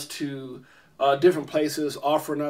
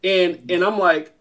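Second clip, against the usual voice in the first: usual speaking voice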